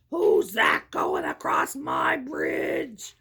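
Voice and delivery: slow, low troll voice; slow troll roar at the goat; troll bellow. A narrator's reading. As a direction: bellow it like a troll under the bridge. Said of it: "he bellowed".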